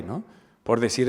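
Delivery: usual speaking voice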